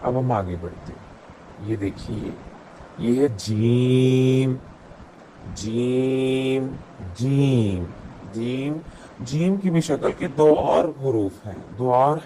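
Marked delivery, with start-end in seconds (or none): 3.0-9.6 slow, carefully drawn out letter name. Shown as a demonstration. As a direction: say each letter name slowly for the learner. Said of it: "teaching letter sounds". (حرف کا نام آہستہ کھینچ کر)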